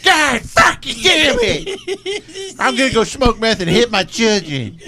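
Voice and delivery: high, drawn-out wail of pretend rage; mock furious outburst. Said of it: "and they'd be like".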